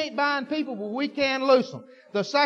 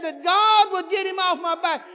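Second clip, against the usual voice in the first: mock tearful plea; 6 semitones higher, 4 dB louder than usual. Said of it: "she said".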